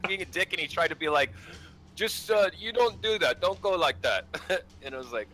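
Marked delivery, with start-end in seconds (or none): 2.0-4.2 imitating a Russian player, a touch higher; lightly mocking Russian accent, scolding. Retelling the teammate who scolded him, in a light Russian accent. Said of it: "I sound so racist right now".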